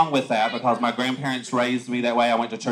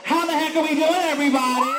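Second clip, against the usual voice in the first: calling out; 14 semitones higher than usual. Real, not acted. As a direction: shout it out to the whole park. Drag queen greets the crowd.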